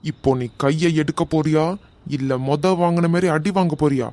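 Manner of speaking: loud, hard ultimatum; menacing threat. Acted. Man threatening to hit her again, loud. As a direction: threaten him with another beating.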